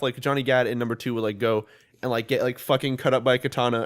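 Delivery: usual speaking voice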